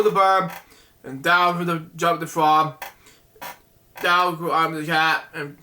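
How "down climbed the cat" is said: ordinary delivery, nothing marked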